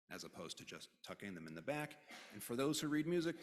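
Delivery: ordinary talk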